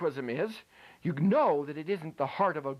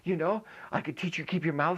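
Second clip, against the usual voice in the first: ordinary talk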